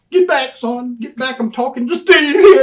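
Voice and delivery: high, loud get-back bark; brush-off shout. Retold or imitated. Manager shooing Brian away from Steve, high loud bark. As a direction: shove him away with your voice.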